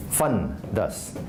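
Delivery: ordinary talk, nothing marked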